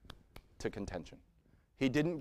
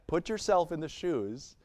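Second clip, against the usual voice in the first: usual speaking voice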